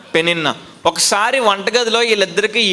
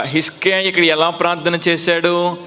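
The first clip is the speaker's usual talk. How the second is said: normal talking voice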